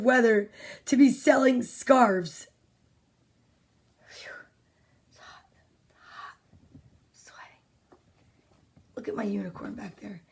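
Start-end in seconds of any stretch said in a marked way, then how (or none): 4.1-7.6 whispering. Real, not acted. Whispered complaint about heat. 8.9-10.2 whispering. Real, not acted. Whispered quick aside.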